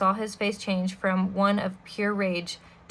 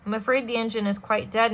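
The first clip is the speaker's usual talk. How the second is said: ordinary delivery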